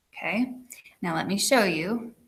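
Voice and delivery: ordinary talk, nothing marked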